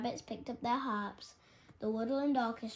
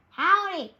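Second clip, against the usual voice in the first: loud high "Howdy" shout; calling out; 8 semitones higher, 14 dB louder than usual. A narrator's reading. Rowdy wolf hollers at the sleepy forest. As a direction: holler a brash greeting. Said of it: "called one wolf".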